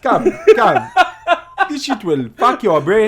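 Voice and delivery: put-on high laughing accented voice; mock African accent. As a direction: offer it like a street dealer.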